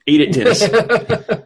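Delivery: laughing, slow and loud; giggling egging him on. Real, not acted. Urging, laughing.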